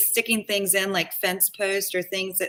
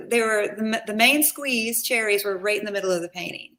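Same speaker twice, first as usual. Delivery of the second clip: ordinary talk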